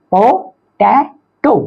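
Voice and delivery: very slow "पो-टै-टो"; पो, टै, टो with pauses; syllable by syllable. Shown as a demonstration. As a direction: break पोटैटो into three separate beats. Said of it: "ट्राइसिलेबिक".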